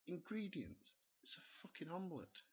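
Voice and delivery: how can an omelette need ingredients; incredulous. Real, not acted. Scoffing at the recipe.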